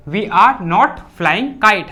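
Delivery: very slow, word by word for the class; slow dictation. Shown as a demonstration. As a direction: spell it out slowly so students can write. Teacher dictating English sentence slowly. (बहुत धीरे, शब्द-दर-शब्द बोलना)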